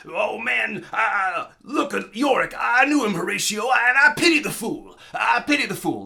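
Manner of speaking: high, loud, goofy ham acting; hammed-up impression, breaking character for a laugh; Mister T impression. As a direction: ham up Hamlet like a goofy action star. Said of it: "breaks character and decides to maybe ham it up".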